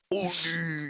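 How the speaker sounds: put-on man voice after the squeak; hammed-up man's Oni-chan; deepest man voice. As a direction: switch to a mock manly voice. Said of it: "Put on your deepest man voice possible".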